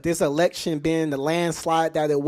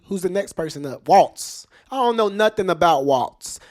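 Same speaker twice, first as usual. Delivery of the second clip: usual speaking voice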